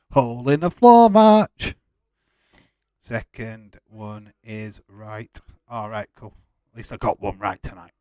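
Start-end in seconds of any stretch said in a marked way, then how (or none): 0.1-1.8 gleeful repeat. Acted. Repeats joke name loudly.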